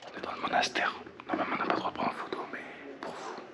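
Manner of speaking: hushed whisper inside a temple. Real, not acted. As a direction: keep it to a whisper.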